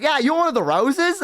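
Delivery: florist on phone. Retold or imitated. Florist to customer confirming the roses, lightly mimicked caller.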